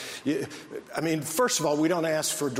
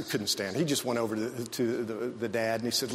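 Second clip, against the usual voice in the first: ordinary talk, nothing marked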